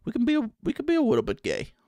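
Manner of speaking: high, quick, put-on teasing voice; coy joking. Streamer joking with chat about Pride Month, coy high voice. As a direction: pitch it way up, playfully coy.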